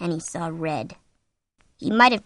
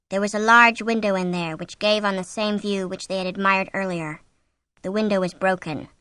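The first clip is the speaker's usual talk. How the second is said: usual speaking voice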